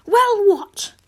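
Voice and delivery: high, loud impatient snap; frustrated demand. Acted. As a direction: push him to spit it out. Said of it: "getting frustrated with him stalling".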